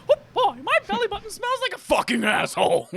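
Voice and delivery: very high falsetto mouse voice; Mickey Mouse. Acted. Answering in the same bit, squeaky mouse impression. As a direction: squeak it all the way through. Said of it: "Mickey and Minnie Mouse voice".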